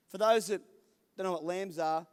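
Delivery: ordinary talk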